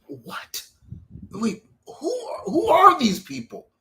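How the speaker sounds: shocked, demanding who these people are; outraged disbelief. Real, not acted. Fan ranting about the film, outraged disbelief.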